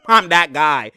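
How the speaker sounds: loud and slow, cocky; slow cocky boast; slow swagger brag. Real, not acted. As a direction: lean on it slowly, full of swagger. Bragging after laughing.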